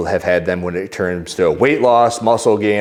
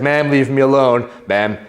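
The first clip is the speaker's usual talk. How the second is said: higher, repetitive mock protest; retold protesting worker; 5 semitones higher than usual. Worker to woman photographing him, mock protesting. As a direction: imitate a harassed man objecting, pitched up. Harassed worker begs to be left alone.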